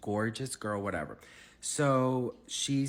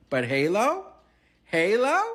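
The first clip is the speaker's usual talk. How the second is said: loud, high rising disbelief; incredulous question; 10 semitones higher, 10 dB louder than usual. Retold or imitated. Incredulous at the song choice.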